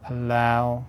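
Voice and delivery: ordinary talk, nothing marked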